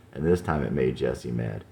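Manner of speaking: plain reading voice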